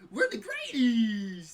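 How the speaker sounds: loud, high, slow cartoon Uncle Sam; hammed-up Uncle Sam bellow. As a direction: boom it out like a goofy patriot.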